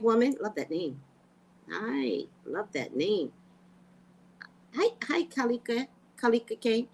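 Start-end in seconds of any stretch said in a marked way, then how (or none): none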